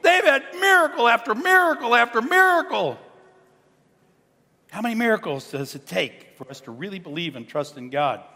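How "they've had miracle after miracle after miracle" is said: exasperated. Retold or imitated. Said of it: "look at these people and say".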